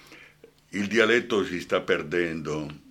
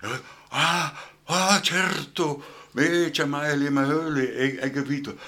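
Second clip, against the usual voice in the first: put-on higher, slowed voice of an old man lighting up; delighted old man; 7 semitones higher than usual. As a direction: play an old listener brightening at a remembered dialect word. Old folks hearing a dialect word. (anziano che si illumina, voce più alta e lenta)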